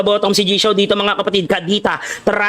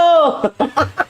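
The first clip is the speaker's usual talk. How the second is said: short high burst of laughter; laughing; 4 semitones higher than usual. Real, not acted. Streamer cracking up at the game, laughing. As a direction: let out a quick, high laugh. Laughs after his own exclamation.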